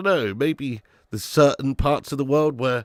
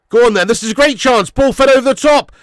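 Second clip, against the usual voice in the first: loud, higher football commentary; excited commentary; 7 semitones higher, 10 dB louder than usual. Real, not acted. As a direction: call the chance with rising excitement. Live match commentary, big chance.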